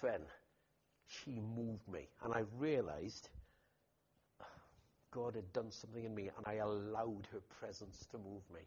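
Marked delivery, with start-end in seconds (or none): none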